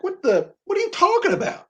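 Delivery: baffled squeal at an absurd claim; incredulous reaction. Acted. Pundit reacting to a coach's threat, incredulous.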